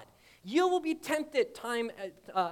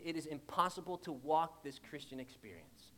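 ordinary talk, nothing marked

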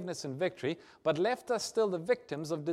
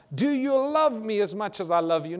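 higher and louder, pressing; urgent pleading Jesus; 4 semitones higher, 7 dB louder than usual. Acted. Jesus pressing his question on the believers, louder and urgent. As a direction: lean in and press the question hard.

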